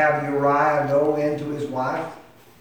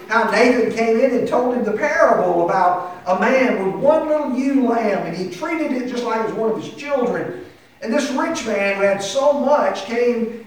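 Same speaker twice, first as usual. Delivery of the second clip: raised, high preacher's delivery; forceful preaching; 9 semitones higher, 6 dB louder than usual. Real, not acted. Preacher telling congregation of Uriah's betrayal, forceful and raised. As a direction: drive the story home with preaching force.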